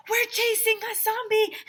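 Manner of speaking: put-on very high, loud kid yell; shout. AJ shouting to Mister Klutz that they're chasing a zombie. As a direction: call out to the principal mid-run. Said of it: "I shouted to him".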